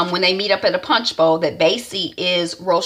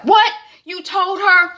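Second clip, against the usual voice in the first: much higher, louder startled what; shocked outburst; 13 semitones higher, 3 dB louder than usual. Retold or imitated. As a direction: blurt it in disbelief. Isaiah shocked at Zora.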